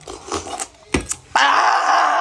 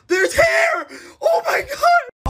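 high, crying mock panic; panicked wail; 14 semitones higher, much slower than usual. Boy watching his eyebrows fall off, wailing. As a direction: wail in fake despair.

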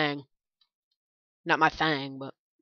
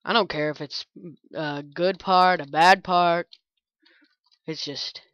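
usual speaking voice

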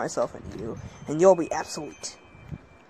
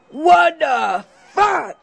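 drawn-out yell of disbelief, higher; shocked shout; 9 semitones higher, 10 dB louder, much slower than usual. Acted. Seeing his possessed friend, a slow shocked yell. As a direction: stretch it out, yelling in shock.

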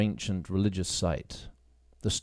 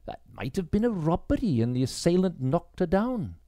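higher, halting woman's voice; Grace, hesitant; 7 semitones higher than usual. Acted. Grace wondering at the temple, higher and hesitant. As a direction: stumble over the words, surprised.

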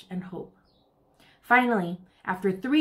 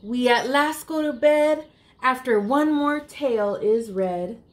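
put-on reading to children; 5 semitones higher than usual. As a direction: read it like a bedtime picture book for little ones. Reads rhyming picture book aloud.

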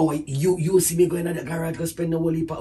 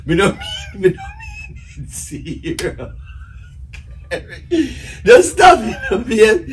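pleading through laughter, louder; laughing pleading; 3 semitones higher, 4 dB louder, slower than usual. Real, not acted. Husband begging his wife to stop, laughing hard. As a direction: beg her to stop while laughing.

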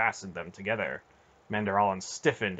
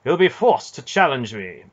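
loud, grim and determined; 5 semitones higher, 8 dB louder than usual. A narrator's reading. Knight vowing to force a duel, grim and loud. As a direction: state it like an iron vow. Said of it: "Manderallen said grimly".

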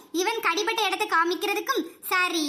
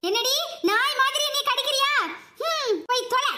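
sharp, higher cry after being bitten; pained angry outburst; 3 semitones higher than usual. Acted. Husband snaps at wife who bit him, pained and angry. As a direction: snap at her for biting.

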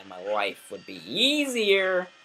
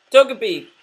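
excited; 6 semitones higher, 10 dB louder than usual. Real, not acted. Announcing the Pokemon's name.

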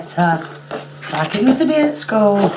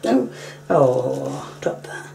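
whisper; 5 semitones lower, 5 dB quieter, much slower than usual. Real, not acted. Hushed scolding of the cat.

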